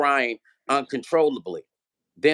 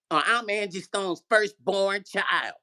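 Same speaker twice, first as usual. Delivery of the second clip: slow, higher, haughty mimicry; mock self-important; 5 semitones higher, slower than usual. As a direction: drag out her grand introduction. Mocking Diamond's self-important introduction.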